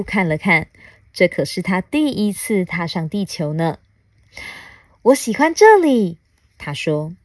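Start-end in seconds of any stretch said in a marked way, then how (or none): 5.0-6.2 high, loud storybook alien; delighted little alien. Acted. Alien on first visit to Earth, delighted. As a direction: pipe up as a pleased little alien.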